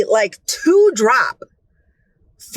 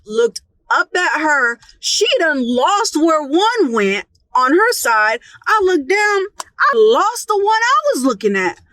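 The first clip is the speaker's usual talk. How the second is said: normal talking voice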